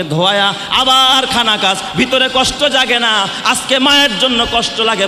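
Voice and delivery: fiery sermon rebuke; preaching. Acted. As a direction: preach it out, scolding the crowd. Waz preacher scolding the congregation.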